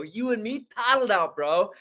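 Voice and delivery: high, loud excited shout to his buddy; excited retelling. Retold or imitated. Telling his surf buddy they made it, excited. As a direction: relive the thrill of paddling out.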